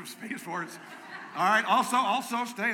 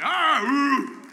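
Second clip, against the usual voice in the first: much higher, shouted whoop; army holler; 13 semitones higher, 13 dB louder than usual. Shown as a demonstration. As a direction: show them how to holler. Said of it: "you're supposed to holler like".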